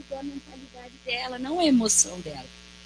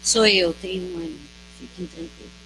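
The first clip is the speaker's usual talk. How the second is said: calm reassurance; 5 semitones lower than usual. Retold or imitated. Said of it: "ele diz assim".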